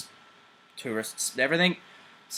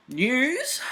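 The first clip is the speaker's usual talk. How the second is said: playful; 14 semitones higher, 4 dB louder than usual. Acted. Teasing announcement of news.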